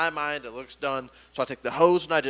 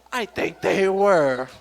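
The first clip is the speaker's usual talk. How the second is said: slow, drawn-out echo in own register; pointed parody; 3 semitones higher, 4 dB louder, slower than usual. Retold or imitated. Comedian turns coworker's line back on her, slow and pointed. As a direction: stretch it out and throw it back.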